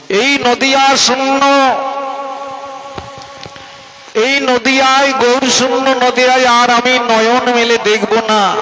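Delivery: tearful lament. Acted. Haridas refusing to see Nadia without Gour, acted lament. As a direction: wail the words high, easing slightly at the end. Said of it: "Haridas Thakur কেঁদেছিলেন".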